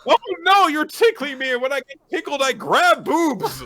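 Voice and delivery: high, loud panicked excuse; mock panic. Boy excusing himself to her, high and hammed-up. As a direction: ham up the flailing excuse. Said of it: "mock character excuse".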